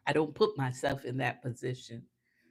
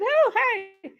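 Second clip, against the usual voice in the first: bubbly greeting; 9 dB louder than usual. Retold or imitated. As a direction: sing out a much higher, louder hi. Greeting the Uber driver.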